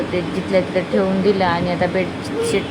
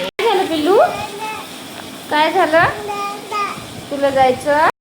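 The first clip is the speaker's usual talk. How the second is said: tender asking; 10 semitones higher than usual. Real, not acted. Mother asking her little one what happened, high tender voice. Asking child what's wrong.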